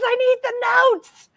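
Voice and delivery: hammed-up high-pitched plea; mock urgent demand. Host imitating her colleague wanting printed notes, mock demand.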